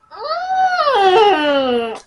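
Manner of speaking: high drawn-out whining mm; long high whimper, hammed up; whiny squeal. Reliving hand touch, whines.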